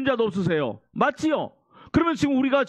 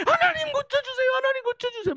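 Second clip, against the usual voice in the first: very high-pitched, imitated pleading prayer; mock desperate begging; 14 semitones higher than usual. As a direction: beg God in an exaggerated, high, thin-pitched wail. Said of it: "막 그러면서 애걸복걸하는 기도를".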